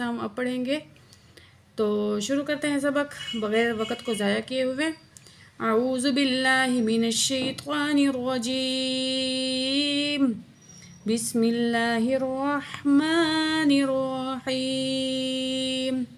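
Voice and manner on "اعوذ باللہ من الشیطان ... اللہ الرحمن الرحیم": slow, drawn-out tajweed chant; Quran recitation. Shown as a demonstration. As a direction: recite slowly with long vowels. Teacher opens Quran recitation. (آہستہ تجوید کے ساتھ تلاوت)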